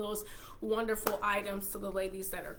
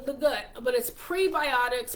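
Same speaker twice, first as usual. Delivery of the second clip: normal talking voice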